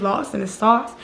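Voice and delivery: ordinary talk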